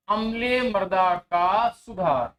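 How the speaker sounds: slow, measured heading for note-taking; slow dictation. Real, not acted. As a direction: dictate it slowly, word by word. Teacher slowly dictates the heading. (धीमे-धीमे लिखवाते हुए)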